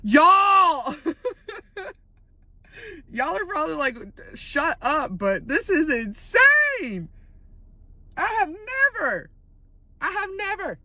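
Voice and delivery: disbelief. Real, not acted. Police chase outside Walmart.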